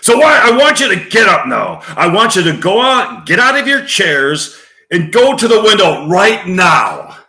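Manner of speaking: high, loud, urgent shouting; rally everyone at full volume; fired-up movie tirade; angry rousing rant. Acted. Acted Network rant, rousing the crowd.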